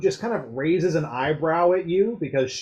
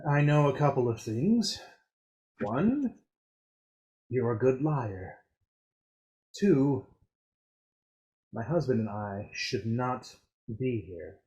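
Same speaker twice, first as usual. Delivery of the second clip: softer, lower, secretive; hushed confidence; 4 semitones lower, 6 dB quieter than usual. Acted. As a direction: keep it low so nobody hears. Norshella's hushed confession.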